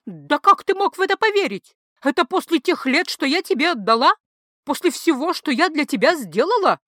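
wife hurling reproach at husband; furious reproach. A narrator's reading. Wife's hurt furious reproach. (гневный упрёк жены)

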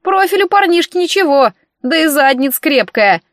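high-pitched eavesdropping granny; shouting old woman. A narrator's reading. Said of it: "закричала старуха".

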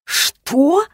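high-pitched incredulous question; a startled leap up in pitch; taken aback; shocked. A narrator's reading. Shocked reply to an insult.

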